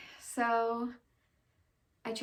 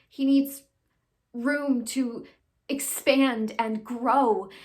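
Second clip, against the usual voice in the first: louder, impassioned stage plea; dramatic monologue; 9 dB louder than usual. Acted. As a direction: plead his case with forceful stresses. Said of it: "I'll be doing a monologue".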